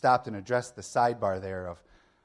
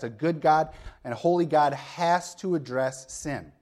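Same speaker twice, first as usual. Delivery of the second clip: ordinary delivery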